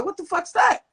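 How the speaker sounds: retold startled outburst, pitch jumping far up; shocked exclamation. Spotting an unknown CD at a friend's house, high shocked exclamation. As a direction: blurt it out in high disbelief.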